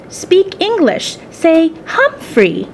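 slow, high, careful prompting; slow coaching. Acted. Daughter teaching her mother English, slow and high. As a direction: spell it out slowly for a learner.

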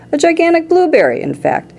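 plain reading voice

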